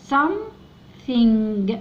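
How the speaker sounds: something very slowly, th as s; something drawn out. Shown as a demonstration. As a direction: stretch something out for learners. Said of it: "تنطق ساء هنا". (نطق something ببطء شديد)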